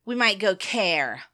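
Care said with a full American R. Shown as a demonstration. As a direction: sound the final R in Care. American way of shortening Karen.

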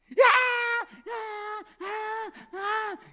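put-on very high, loud wailing baby cries; piercing mock infant wail; imitated baby cry. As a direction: wail like a newborn demanding the bottle. Imitating a newborn crying at night.

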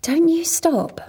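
urgent whisper. Acted. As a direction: hiss it under your breath. Urging the teller secretly.

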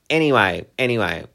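loud, firm anyway to move on; brushing it off. Real, not acted. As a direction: shake it off and change the subject. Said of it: "let's move on".

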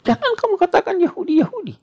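high-pitched, quick scolding in retelling; angry rebuke. Retold or imitated. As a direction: act out an indignant reprimand, pitch jumping up. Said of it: "Nabi marah".

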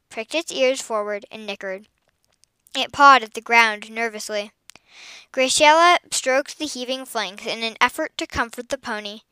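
usual speaking voice

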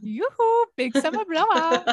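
put-on high, loud singsong Swedish accent; Swedish shopkeeper impression. Fan copying the guy from Frozen, high Swedish accent. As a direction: hail customers in a comic Swedish accent. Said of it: "the Swedish guy".

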